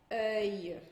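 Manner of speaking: "œil" with the final glide lengthened; I mais comprido. Shown as a demonstration. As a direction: say "œil" and carry the I a little longer. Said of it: "dá uma compridada no I". ("œil" com o I final alongado)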